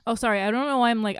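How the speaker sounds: tearful. Real, not acted. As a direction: apologise through sudden tears. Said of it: "I started crying".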